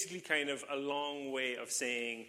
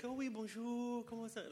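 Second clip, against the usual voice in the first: higher, softer sing-song French "Oh, oui, bonjour"; mock French locals; 8 semitones higher, 5 dB quieter than usual. Pastor imitating the relaxed Strasbourg locals, higher, softer French lilt. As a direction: lilt it like a chirpy Strasbourg shopkeeper. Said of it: "And they're like".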